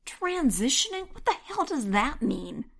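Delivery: high, shocked question; disbelieving, high-pitched; incredulous. A narrator's reading. Heroine incredulous.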